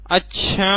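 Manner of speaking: "अच्छा" with its last vowel held long; drawn-out अच्छा. Shown as a demonstration. Teacher repeating a word to show stretching, drawn out. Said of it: "अच्छा को खींच रहे हैं sir".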